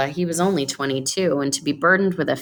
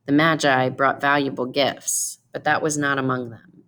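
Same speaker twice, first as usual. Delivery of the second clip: ordinary delivery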